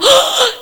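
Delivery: high, loud gasp of wonder; amazed gasp. Retold or imitated. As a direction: gasp in delight. Amazed car started.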